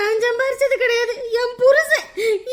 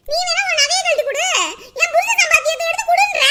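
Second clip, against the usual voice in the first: indignant quarrel; 6 semitones higher than usual. Acted. Mother-in-law quarrels with daughter-in-law.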